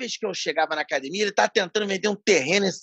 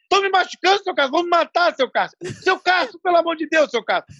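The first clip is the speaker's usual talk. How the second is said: high, pitched-up hammed pleading; mock desperate yelling; 9 semitones higher than usual. Retelling Fininho begging Cássio during the beating, high mock wail. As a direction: wail for help like a beaten kid. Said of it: "E ele gritando".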